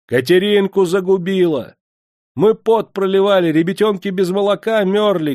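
high, loud, furious women's cry; furious shout. A narrator's reading. Angry women screaming at Yulka. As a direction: scream it at the hated girl. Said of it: "Яростно закричали жонки".

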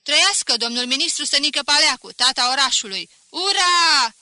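mock cheer yelled from the back; shouting from the back. A narrator's reading. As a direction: hail the minister at full voice. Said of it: "Careva din fund strigă".

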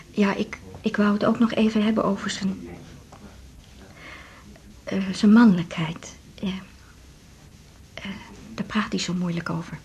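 hesitant, hushed and awkward; a wife discreetly raising her husband's manhood; embarrassed whisper. Acted. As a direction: whisper it, too shy to say aloud.

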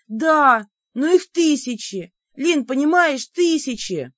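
high, loud-edged insistence; agitated protest. Acted. Heroine makes the demon grasp the numbers, agitated.

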